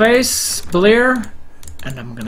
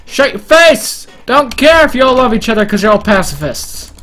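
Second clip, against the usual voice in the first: hammed-up angry shout, higher and louder; mock fury; 5 semitones higher, 5 dB louder than usual. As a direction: bellow it in fake rage at the pacifists. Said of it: "I don't know why I'm so angry".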